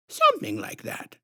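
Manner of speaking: syrupy sinister clown woman introducing herself; creepy clown lady. A narrator's reading.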